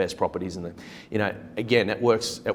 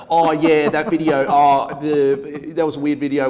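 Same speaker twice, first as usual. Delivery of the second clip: higher, louder flustered cover-up; brushing it off awkwardly, pitched up; awkward mock reply; 6 semitones higher, 9 dB louder, slower than usual. Agent retelling his flustered reply to the owner, higher and louder. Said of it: "And I was like".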